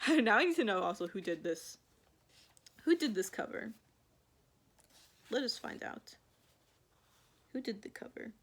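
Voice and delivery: ordinary delivery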